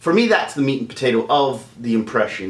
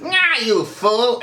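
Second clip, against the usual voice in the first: slow, high villain catchphrase; Skeletor; 11 semitones higher, much slower than usual. Shown as a demonstration. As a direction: stretch it out in a high cartoon villain voice. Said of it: "Get that sentence".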